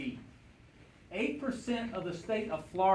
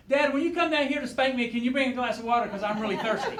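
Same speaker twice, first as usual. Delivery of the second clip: higher, louder acted child's voice; little boy pleading; 6 semitones higher, 5 dB louder than usual. Five-year-old asking his dad for water, high pleading call. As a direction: call out like an eager small boy. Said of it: "the little boy goes".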